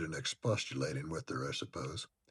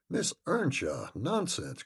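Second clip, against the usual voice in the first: higher, slow, disbelieving cry; 6 semitones higher, slower than usual. A narrator's reading. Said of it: "cried the dame".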